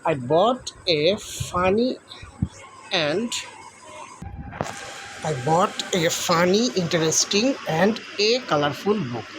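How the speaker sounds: slow, word by word; slow dictation. Shown as a demonstration. Teacher dictates the fixed sentence to pupils. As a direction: read the sentence out slowly. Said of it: "তাহলে এ বাক্যে হবে".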